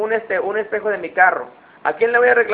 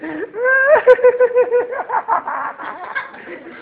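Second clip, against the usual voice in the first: high mock giggle of a kid who got away with it; child's sneaky laugh. Child laughing after fooling the parent, sly high giggle.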